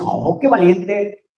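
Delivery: slow, drawn-out mock praise; mock admiration. Onlookers praising a man who never cries, in slow mock admiration. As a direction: admire them sarcastically.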